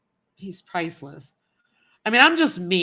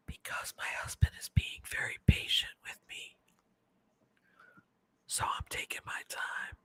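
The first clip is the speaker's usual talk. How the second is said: whispering; 10 dB quieter than usual. Real, not acted. Whispering aside about husband.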